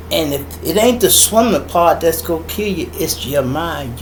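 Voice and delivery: normal talking voice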